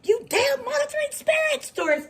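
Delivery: mock-furious shriek at invisible spirits; retold yell. Yelling at the spirits over spilled creamer, high mock shriek. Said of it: "how loud I was".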